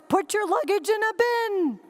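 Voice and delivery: calm demo of better phrasing; simple clear instruction. Shown as a demonstration. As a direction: spell it out kindly. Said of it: "All she had to say was".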